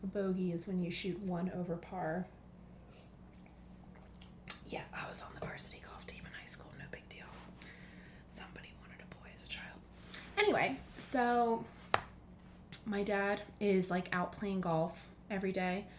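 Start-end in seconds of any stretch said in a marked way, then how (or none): 4.7-9.8 hushed, fast confiding whisper; whispered aside. Real, not acted. Whispering a joke to the listeners.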